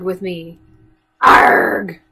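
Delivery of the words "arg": arg. Shown as a demonstration. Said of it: "Can you call out".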